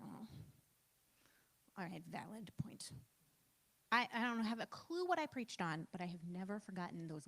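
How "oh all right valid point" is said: whispered, deflated; a whispered retold "fair enough"; whispered concession. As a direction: breathe out a sheepish giving-in. Conceding after professor's question.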